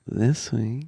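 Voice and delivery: soft breathy "This week" mocking his radio voice; mock sultry imitation. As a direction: imitate his horny NPR read. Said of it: "You go".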